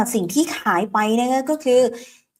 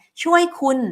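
eager rider; 9 semitones higher, 4 dB louder than usual. Retold or imitated. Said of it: "พี่แก๊ปบอก".

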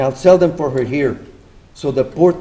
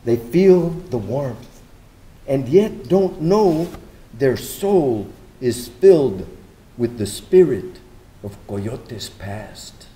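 poem reading; 3 dB quieter than usual. Acted. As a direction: read it like a poem about the city. Poetic narration between speakers.

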